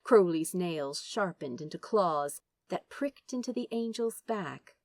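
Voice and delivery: plain reading voice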